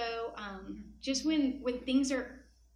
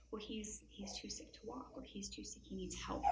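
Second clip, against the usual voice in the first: whispered reply; 2 semitones lower, 13 dB quieter than usual. Retold or imitated. Grandfather whispering answer to child.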